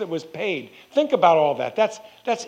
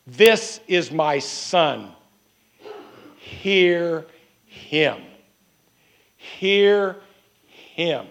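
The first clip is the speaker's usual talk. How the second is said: very slow, solemn divine pronouncement; drawn-out words with weighty pauses between them; voice from the cloud; 2 semitones lower, much slower than usual. Acted. Preacher voicing the voice out of the cloud, slow and solemn. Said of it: "the voice just simply said".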